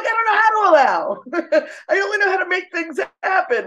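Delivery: high, laughing mock-helpless quote; laughing self-quote. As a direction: squeal it through a laugh, baffled. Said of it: "I'm like".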